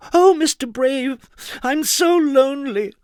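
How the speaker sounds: a hammed-up lonely wail; sobbing. A narrator's reading. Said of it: "she sobbed".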